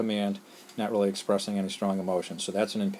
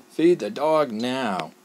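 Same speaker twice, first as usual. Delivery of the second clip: slow, louder and higher parent's order; mock angry yell; 4 semitones higher, 5 dB louder, much slower than usual. Mom or dad mad the dog wasn't fed, yelling the order. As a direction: bark it like a mad parent, drawn out. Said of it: "They kinda yell".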